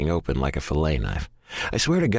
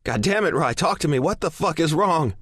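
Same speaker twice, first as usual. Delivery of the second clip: higher, panicked yell; 9 semitones higher than usual. A narrator's reading. Frantic man yelling into his phone, panicked yell. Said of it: "I yell into the phone".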